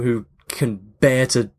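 ordinary delivery

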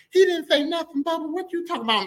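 high-pitched defensive denial; acted high female voice; protesting mom. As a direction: imitate a wife brushing him off. Mom denies it to dad.

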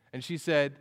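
ordinary delivery

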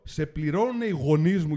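loud, slow angry retort; indignant confrontation. Retold or imitated. Confronting the port official who turned away, loud and indignant.

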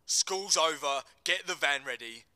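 loud, hard commands to the gang; loud barked orders. Acted. Johnson commanding the gang to ready the van, loud orders. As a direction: order them out, no room to argue.

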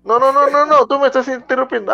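indignant protest. Real, not acted. Protesting interruption in quarrel.

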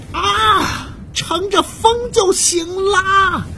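high, slow triumphant shout; excited cry. Acted. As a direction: cry out as he lifts into the wind. Pterosaur takes off flying. (兴奋地高喊)

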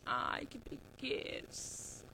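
suspiro arrastado e lento, quase sem voz; preguiçoso. Retold or imitated. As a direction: solte a frase como quem não tem energia nenhuma. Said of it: "você fala assim". ("Ai, que preguiça" arrastado e sussurrado)

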